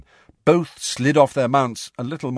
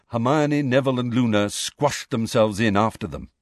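usual speaking voice

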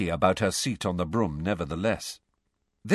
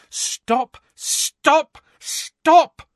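building scream, higher and louder each time; 8 dB louder, much slower than usual. Acted. Angelina halting the drill, screaming louder each time. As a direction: shriek to halt the practice. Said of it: "screamed Angelina".

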